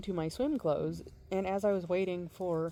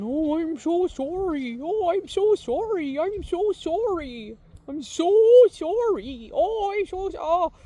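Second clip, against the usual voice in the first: gushing apology; 11 semitones higher, 11 dB louder than usual. Retold or imitated. Woman overdoing apologies to the kid, high and loud. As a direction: ham up a frantic over-the-top sorry. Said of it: "the woman starts going".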